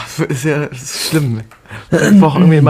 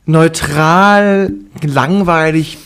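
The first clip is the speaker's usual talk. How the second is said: raised, pressed disappointment; annoyed rant; 6 semitones higher, 4 dB louder, slower than usual. Real, not acted. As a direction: vent your letdown loudly. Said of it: "du siehst so angepisst aus".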